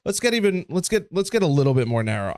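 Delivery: normal talking voice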